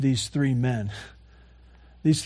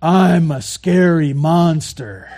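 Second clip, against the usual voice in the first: slow, loud mock-monster announcement; playful imitation of a scary monster; kid playing monster; 5 dB louder, slower than usual. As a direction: draw it out like a child playing monster. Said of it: "and they say".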